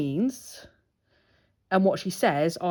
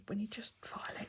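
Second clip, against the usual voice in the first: whisper; 2 semitones higher, 19 dB quieter, faster than usual. Real, not acted. Whisper over nail filing.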